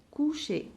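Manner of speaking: coucher ending in a clear é. Shown as a demonstration. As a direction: say coucher with the final é sound. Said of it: "E R se prononce E". (coucher prononcé avec un é final)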